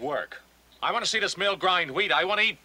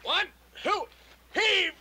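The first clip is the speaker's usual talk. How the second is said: straining call; 13 semitones higher, 3 dB louder, much slower than usual. Acted. Heaving the mill stone.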